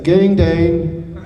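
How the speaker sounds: lightly imitated South African lift voice; a hint of a South African announcement voice; South African elevator voice. Comic mimicking the hotel elevator, light South African accent. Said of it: "That's exactly what she sounds like".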